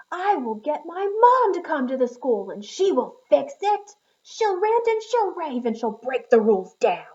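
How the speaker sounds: loud, high angry shout; shouted threat. Acted. Schubert shouting at his teacher that mom will come, loud. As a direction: bellow it like a furious kid. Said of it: "and he shouted".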